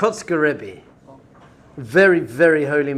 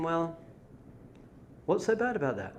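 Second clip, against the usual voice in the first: very soft, unbothered question; calm reply; 13 dB quieter than usual. Retold or imitated. Rebbe to the angry villager, softly unbothered. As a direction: answer the outburst in a hushed, mild voice. Rebbe calmly answers the outburst.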